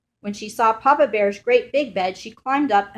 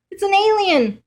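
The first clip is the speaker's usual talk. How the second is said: whispered excitedly; 11 semitones higher, 5 dB louder than usual. Acted. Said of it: "Little Wee Bear whispered excitedly".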